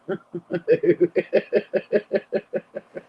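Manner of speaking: long helpless belly laugh; hearty laugh. Real, not acted. Host cracking up at a chat joke, hearty laugh. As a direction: laugh until your ribs ache.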